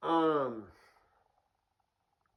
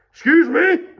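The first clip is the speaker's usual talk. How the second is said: very high, loud challenge; indignant mock yell; 18 dB louder than usual. Cocky twenty-two-year-old to the cop, indignant yell. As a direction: shout it in hammed-up outrage. Acted young self at officer.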